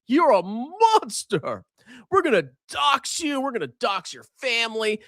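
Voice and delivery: high, sneering mock outrage voice; mocking leftist scold. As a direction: whine it like a pearl-clutching scold. Mocking imagined leftist critic.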